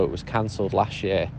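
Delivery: ordinary talk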